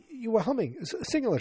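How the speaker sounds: high, eager surprised dad; excited surprise. Retold or imitated. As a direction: lift it up in wonder. Mike surprised at Carol.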